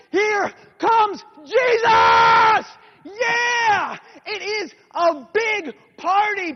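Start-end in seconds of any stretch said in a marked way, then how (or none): 0.1-4.0 very high, slow, loud cheer; excited cheer. Acted. Crowd cheers as Jesus arrives, excited shout. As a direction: whoop it up like a street party. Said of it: "They're so excited".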